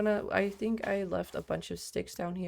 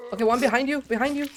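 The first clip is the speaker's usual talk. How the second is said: urgent warning; 7 semitones higher, 6 dB louder, faster than usual. Real, not acted. Warning teammate of enemy.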